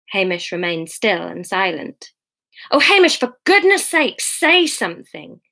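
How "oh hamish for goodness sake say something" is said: higher, louder exasperated outburst; exasperated plea. Acted. Sarah to silent Hamish, exasperated plea. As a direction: implore him in exasperation, stretching the last words.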